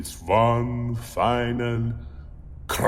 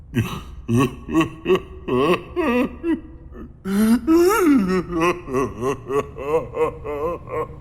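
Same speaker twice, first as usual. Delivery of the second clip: anguished creature wail; 7 semitones higher than usual. Acted. The creature exclaims in despair.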